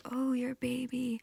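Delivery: soft high whisper, a gentle echo back. Acted. Bird creatures echoing the goddess's grief, soft whispered echo. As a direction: hush it back to her as a witness. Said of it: "And they say".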